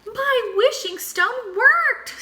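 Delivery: high, slow thrilled squeak; squeaky delight. A narrator's reading. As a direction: stretch it out in a high squeak of joy. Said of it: "squeaked Flamingo".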